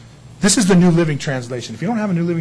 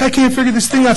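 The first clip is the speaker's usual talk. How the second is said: exasperated; 9 semitones higher, 7 dB louder than usual. Retold or imitated. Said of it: "and so I'm like".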